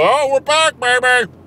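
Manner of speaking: mocking dopey fans. Ranting vlogger mimicking dope-ass fans, mocking. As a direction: parody the gloating idiot fan. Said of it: "some dope-ass fans who would go".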